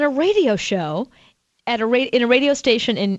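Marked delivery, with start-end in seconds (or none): none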